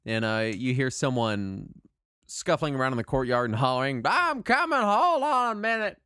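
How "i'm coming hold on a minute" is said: high, loud calling voice; calling out gatekeeper. Acted. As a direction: shout from across the courtyard.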